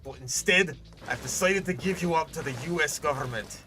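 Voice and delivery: mock-solemn Scottish father; Scottish accent. Father giving up his son to the government, Scottish accent. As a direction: play it as a Scottish dad breaking news. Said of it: "that was a good Scottish accent".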